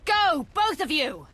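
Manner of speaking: loud, high and slow shouted order; urgent command shout. Acted. Commander ordering two companions to escape, loud slow shout. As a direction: bellow at both of them to get out.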